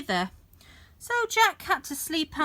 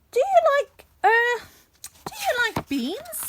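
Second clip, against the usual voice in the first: Jack's voice; 13 semitones higher, 4 dB louder than usual. A narrator's reading. Jack voiced in story.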